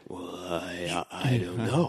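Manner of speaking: low, soft, halting mumbled answer; mimicked evasive hero. Revived man answering suspicious villagers, halting and soft.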